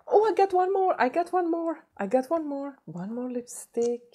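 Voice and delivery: high-pitched delight, easing gradually; excited squeal. Real, not acted. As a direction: squeal with surprise at a find, then settle. Delighted finding one more item.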